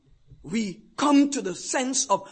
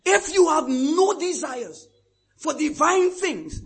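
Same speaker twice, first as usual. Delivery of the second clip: ordinary delivery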